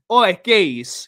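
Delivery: loud, chummy mock call down the phone; imitating Perch. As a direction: call out like a cheeky mate. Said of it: "that's how Perchy talks off stream".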